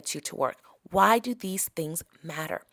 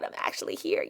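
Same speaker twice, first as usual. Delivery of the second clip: awed whisper; 10 semitones higher than usual. Pretend. Imagined awe in Paris.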